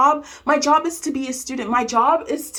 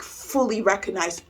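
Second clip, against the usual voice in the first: normal talking voice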